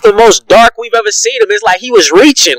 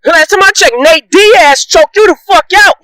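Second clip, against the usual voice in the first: shouting; 6 semitones higher than usual. Real, not acted. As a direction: lean on every word, shouting. Shouting at Conor.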